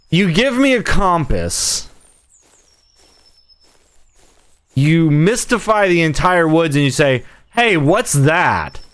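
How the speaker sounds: high, exasperated rant; exasperated complaint. Real, not acted. Complains about the game's vague hints.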